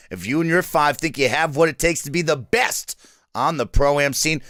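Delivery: ordinary talk, nothing marked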